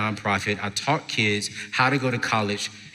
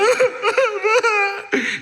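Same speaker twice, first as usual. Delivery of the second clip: high mock sobbing in bursts; exaggerated tearful wail; imitated crying. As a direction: bawl wordlessly, hammed-up. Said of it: "and she's crying".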